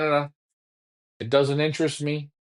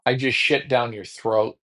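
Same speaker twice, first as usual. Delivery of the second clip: ordinary delivery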